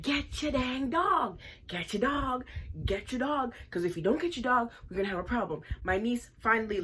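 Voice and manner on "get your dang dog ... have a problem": loud angry yell to get the dog; yelling at the girl. Retold or imitated. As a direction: shout her down, furious. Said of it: "So I start to scream at this little pygmy".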